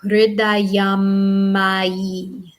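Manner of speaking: Hridayam ma yi slowly, long held M in the middle; slow recitation. Shown as a demonstration. Teacher leading students through a slow Hridayam ma yi. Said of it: "say that with me".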